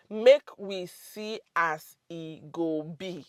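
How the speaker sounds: "Make we see as e go be" slowly, word by word; slow and separated. Shown as a demonstration. Teacher repeats "Make we see as e go be" for learners, slow and deliberate. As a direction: spell out "Make we see as e go be" at dictation speed.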